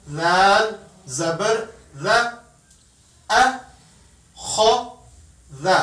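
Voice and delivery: slow spelled syllables अ, ख, द each clipped short; separate short letters. Shown as a demonstration. Teacher drilling children letter by letter, slow and clipped. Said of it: "बिल्कुल short".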